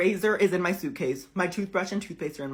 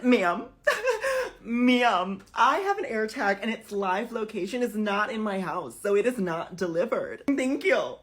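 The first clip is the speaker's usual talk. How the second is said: high, loud, indignant insistence; acted customer outrage; exasperated protest; 5 semitones higher, 4 dB louder than usual. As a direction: push it out loud and high, close to losing it. Arguing with the luggage agent.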